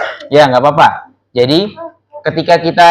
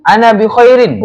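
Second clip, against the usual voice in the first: raised model answer; 7 semitones higher, 4 dB louder than usual. Shown as a demonstration.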